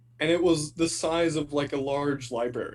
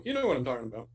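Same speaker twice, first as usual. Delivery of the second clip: ordinary talk, nothing marked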